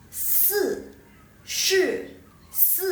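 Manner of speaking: "si, shi, si" each high and sharply falling; fourth-tone drill. Shown as a demonstration. Teacher drills si and shi for the class, fourth-tone contrast. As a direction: alternate hissed s and rounded sh in "si, shi, si", each falling. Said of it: "Ini semuanya nada keempat".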